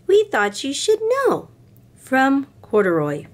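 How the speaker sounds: lightly voiced little toy bear; teddy bear Corduroy. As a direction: read it as a small teddy bear writing. Corduroy's letter read in voice.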